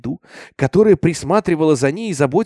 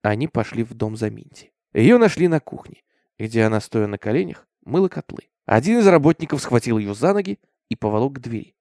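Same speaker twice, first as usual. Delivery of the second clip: ordinary delivery, nothing marked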